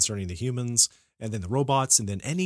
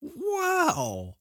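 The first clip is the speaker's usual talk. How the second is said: very high drawn-out wow; amazed wow. Real, not acted. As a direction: marvel in disbelief. Reacts to Nemo twice.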